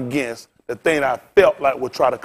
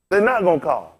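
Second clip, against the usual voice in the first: ordinary delivery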